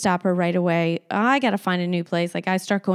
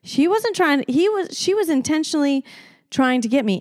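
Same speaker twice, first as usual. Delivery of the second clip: indignant rant; 7 semitones higher than usual. Real, not acted. Ranting about her lie.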